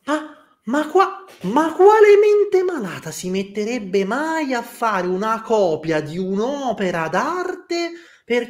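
high, slow indignant rant; scandalised and incredulous, voice cracking high; outraged character voice. Acted.

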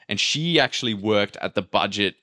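ordinary talk, nothing marked

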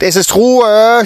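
high, loud and slow, stretching Ruhe; a stern teacher silencing the room; booming call for silence. A narrator's reading. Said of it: "schallte es erneut".

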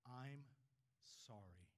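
soft, hushed and humble, "sorry" dropping low; sincere apology. Shown as a demonstration. Preacher showing the congregation a sincere soft apology. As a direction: say "I'm sorry" gently, meaning it fully. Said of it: "the two most difficult words ... to say".

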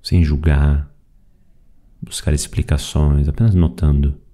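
calm, even meditation voice with long pauses; guided meditation. Acted. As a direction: guide them gently through the breathing, leaving space after each phrase. (meditação guiada, voz calma e pausada)

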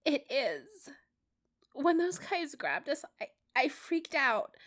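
put-on a scared kid blaming himself; upset, distraught. A narrator's reading. Peter insists to Matt it was his fault, high and distraught.

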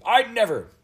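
very high, loud denial; angry cry. A narrator's reading. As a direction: protest hotly at the top of your voice. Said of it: "cried the gentleman angrily".

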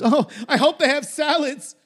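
high, chirpy mock Simmons; Richard Simmons voice. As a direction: chirp it like a perky fitness guru. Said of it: "Simmons replies".

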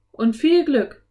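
high, loud send-off; called good wishes. A narrator's reading. Enchantress wishing the children luck, high loud call. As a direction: call after them as they leave. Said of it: "rief Morgan".

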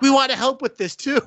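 high, hammed-up girlish voice; mock eager girls. Orphan girls answering the hero, hammed-up high and eager. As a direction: squeak it like naive kids.

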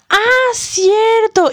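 high, slow surprised "ah"; drawn-out dawning surprise; surprised realization. Retold or imitated. Realizing the guard is right.

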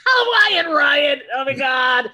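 loud, high laughing shout of recognition; laughing excitement. Real, not acted. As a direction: burst out laughing at the name. Recognizing an old schoolmate's name.

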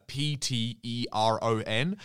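P T E R O N spelt letter by letter, slowly; spelling it out. Shown as a demonstration. Spelling pteron for radio listeners, letter by letter. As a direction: spell out each letter carefully.

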